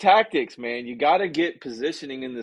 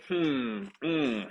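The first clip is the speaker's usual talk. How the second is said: unimpressed stalling; 3 dB louder, slower than usual. Shown as a demonstration. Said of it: "and you wanna go".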